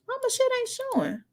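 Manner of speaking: startled by the screen; real surprise, high; surprised. Real, not acted. Device glitch surprise.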